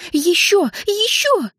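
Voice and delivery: very high squealed cries, climbing; frantic urging. A narrator's reading. Энн frantic, demanding more.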